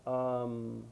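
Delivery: word by word with long pauses; slow dictation. Shown as a demonstration. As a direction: draw each word out as if writing it. Dictates the sentence while writing it.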